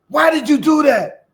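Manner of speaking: high, loud, disbelieving yell; yelling at the snake. Retold or imitated. Young man shouting at a snake that bit itself, indignant yell. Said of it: "yelled at the snake".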